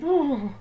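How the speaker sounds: loud, wavering shivery ooh; shivering ooh. A narrator's reading. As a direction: shudder out a long ooh. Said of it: "Douglas shivered".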